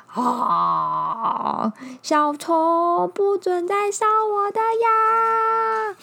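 loud, high, slow cry; ticklish angry bear. Acted. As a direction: wail from being tickled.

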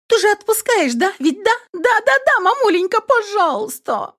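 high wheedling plea; begging daughter. A narrator's reading. Daughter begging mom to let her go, wheedling. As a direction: beg sweetly, stretching please.

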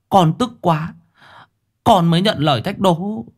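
put-on high child's voice, pleading his case; a young boy defending himself; boy explaining himself. A narrator's reading. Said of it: "Thằng cu cúi đầu thanh minh". (giọng cậu bé cao, thanh minh)

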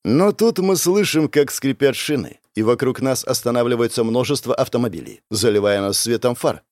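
usual speaking voice

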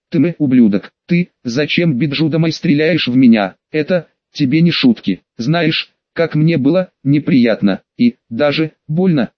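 ordinary delivery

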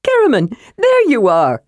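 high, eager calling out; excited shout. A narrator's reading. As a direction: hail a friend with excitement. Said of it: "Tass shouted".

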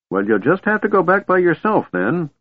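put-on very high, insistent old man; cranky old man. A narrator's reading. Old man sends dragon back alone, cranky. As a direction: order it off in a high voice. Fizban orders dragon home.

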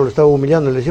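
ordinary talk

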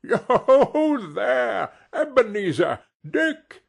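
loud, high, rich and jovial call; hearty old master calling out merrily; jovial Fezziwig. A narrator's reading. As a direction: hail them in a fat, oily, comfortable voice. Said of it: "called out in a comfortable, oily, rich, fat, jovial voice".

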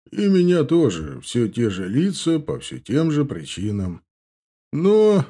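sly, mocking orc; smug orc voice. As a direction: play the cocky young orc. Indgar voiced in story.

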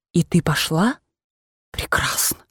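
aghast hush. Acted. Aunt aghast at Liza.

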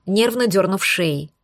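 plain reading voice

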